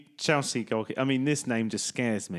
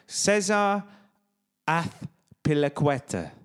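slow Spanish Azpilicueta, ath-pili-KWET-a; Spanish accent; 2 semitones higher, slower than usual. Shown as a demonstration. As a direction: demonstrate it with a lisped Spanish th, slowly. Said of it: "so he's Spanish".

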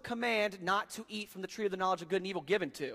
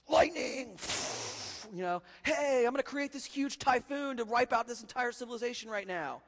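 Roman god; 7 semitones higher than usual. Retold or imitated. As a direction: play a vain thundering god, over the top. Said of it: "the Roman gods who are like".

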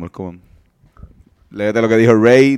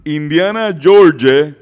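higher, louder, slow announcer read; 6 semitones higher, 4 dB louder, slower than usual. Shown as a demonstration. Reading a fan comment aloud on request, in a higher, louder announcer voice. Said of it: "ponte una voz de locutor".

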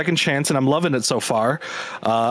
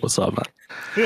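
mock dog voice; 6 semitones lower, 3 dB quieter, much slower than usual. Voicing the dog popping up.

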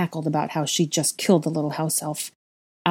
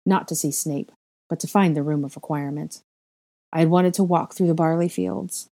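plain reading voice

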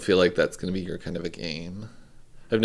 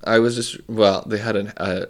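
usual speaking voice

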